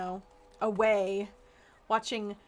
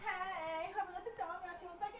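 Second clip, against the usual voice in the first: soft, high question off-mic; quiet aside; 8 semitones higher, 11 dB quieter than usual. Real, not acted. Gamer asking family quietly about the dog, hushed. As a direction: ask the household quietly.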